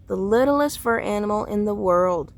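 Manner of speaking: plain reading voice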